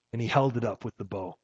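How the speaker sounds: a sermon poem read with feeling; storyteller's reading of a poem; poem recitation. Acted.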